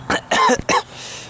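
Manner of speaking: harsh cough from spicy pepper; coughing after a hot chili; coughing from chili heat. Real, not acted. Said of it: "They're really freaking spicy".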